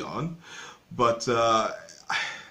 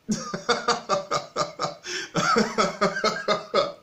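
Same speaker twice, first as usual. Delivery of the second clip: real, high-pitched burst of laughter; high, rolling laughter; long laugh; 8 semitones higher than usual. Real, not acted. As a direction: laugh at length, helplessly amused. Reacts to the host's bad accent.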